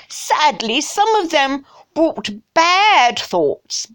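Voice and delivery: hammed-up English snob accent; snotty English. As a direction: drawl it like a snooty English toff. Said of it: "I think snotty English".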